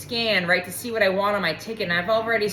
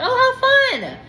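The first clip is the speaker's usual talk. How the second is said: mock delight; 5 dB louder than usual. Mock excitement at the salesman.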